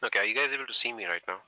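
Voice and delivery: loud, fast shout across a distance; calling out. Real, not acted. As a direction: call out to the group far off. Calling out from far away.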